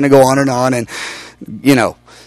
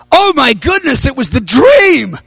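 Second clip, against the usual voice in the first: stunned realization; 9 semitones higher, slower than usual. Real, not acted. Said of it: "I don't say this out loud".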